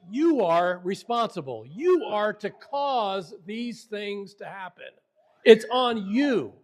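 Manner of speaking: "You" punched high, then slow deliberate weight; emphatic charge. Shown as a demonstration. Teacher voicing the verb's demand on the listener, emphatic charge. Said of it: "the hifil verb stem, it says".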